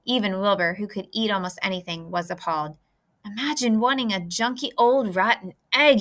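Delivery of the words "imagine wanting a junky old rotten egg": ordinary delivery, nothing marked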